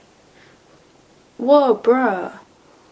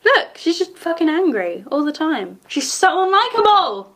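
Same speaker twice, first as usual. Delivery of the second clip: raised voice, fed up with the character; frustrated shouting; 6 semitones higher than usual. Real, not acted. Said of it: "Sorry, I'm shouting".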